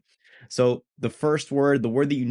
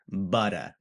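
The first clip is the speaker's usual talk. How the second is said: New England accent; 3 semitones lower than usual. Shown as a demonstration. Teacher shows the hometown sound, New England "butter". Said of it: "the sound that you want to aim for".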